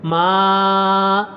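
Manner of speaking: ما with its full madd length; long madd vowel. Shown as a demonstration. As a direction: stretch the ما out long. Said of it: "میم کے اوپر مد ہے".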